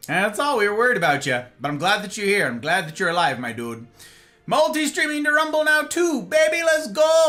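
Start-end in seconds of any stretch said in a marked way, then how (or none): 4.5-7.3 high, excited cheer; hyped announcement. Real, not acted. Streamer announcing a second platform, hyped up. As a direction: crow it with rising hype.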